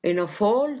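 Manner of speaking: ordinary delivery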